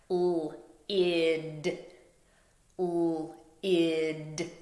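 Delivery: L-id said as separate sounds, l then id; sounds chopped apart. Shown as a demonstration. Teacher chopping L-id apart for the class. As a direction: chop L-id into its sounds. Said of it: "So chop it with me".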